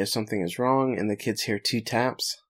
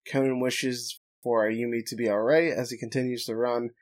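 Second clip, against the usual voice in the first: ordinary talk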